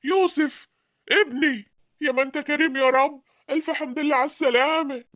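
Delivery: high, thankful cry of relief; father greeting his lost son, high and overjoyed. Acted. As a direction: welcome him back with tearful joy.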